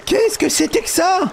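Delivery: high-pitched startled reaction; alarmed disbelief. Acted. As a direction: react high and rattled to creatures pouring out. Des petites bêtes sortent. (stupeur affolée)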